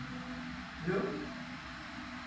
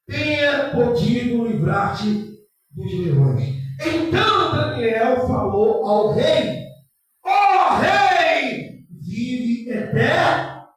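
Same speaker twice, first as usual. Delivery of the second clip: loud, slow, sermon-style scripture reading; slow, loud preacher's Bible reading over music; preaching scripture; 2 semitones higher, 10 dB louder, much slower than usual. Acted. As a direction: proclaim the story to the congregation.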